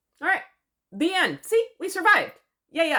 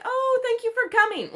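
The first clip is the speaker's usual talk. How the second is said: very high, sing-out fake-sweet welcome; squealed, exaggerated gratitude; mock gushing hostess; 4 dB louder than usual. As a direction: imitate an over-grateful wife greeting her husband. Mimics her gushing welcome to H.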